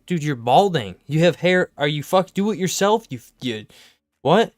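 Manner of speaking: loud, high disbelieving rant; incredulous rant. Real, not acted. Mocking balding protesters over haircuts, loud and incredulous. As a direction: shout it, baffled and mocking.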